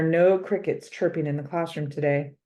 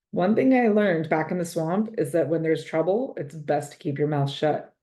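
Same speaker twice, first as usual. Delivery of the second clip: usual speaking voice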